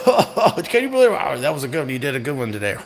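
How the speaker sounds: lampoon them laughing it up at the bar; mocking smug politicians. Politicians joking over drinks after work, mock smug. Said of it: "they're sitting around the table like".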